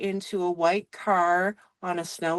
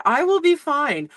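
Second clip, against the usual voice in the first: shaken reassurance; 8 semitones higher than usual. Retold or imitated. Retold shaken self-reassurance.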